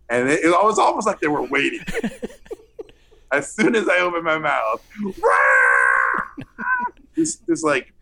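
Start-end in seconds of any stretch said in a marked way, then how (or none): 5.0-6.9 sudden burst of laughter; big laugh. Real, not acted. Laugh at memory.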